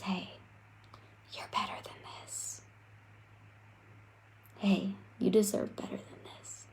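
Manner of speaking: soft breathy whisper of an inner voice, a little quick. Acted. The inner voice urging you when you're down, whispered. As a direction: whisper it like a gentle nudge inside your head. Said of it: "that voice creeps up and it goes".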